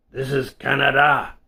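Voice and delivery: a hint of a French-Canadian bartender, planting his feet; French-Canadian accent. Bartender facing down Dan, light French-Canadian accent. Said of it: "he said in a French-Canadian accent".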